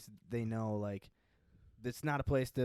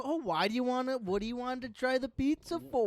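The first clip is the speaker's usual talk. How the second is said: high, gushing mock small talk; mock flowery chattiness; 10 semitones higher than usual. Mocking chatty pizza recommendations New Yorkers skip, flowery and gushing. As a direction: parody over-friendly chit-chat, pitched way up. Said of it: "there's no flowery bullshit".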